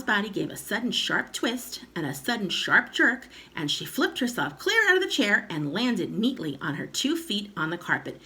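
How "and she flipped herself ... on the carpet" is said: plain reading voice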